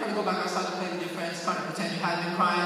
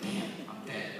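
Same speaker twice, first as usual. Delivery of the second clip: whispered, softer, resigned dread; doomed whisper; 8 semitones lower, 8 dB quieter, slower than usual. Retold or imitated. Boy sees his dad come for him, whispered dread. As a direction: breathe it out like you know you're finished. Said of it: "I'm like".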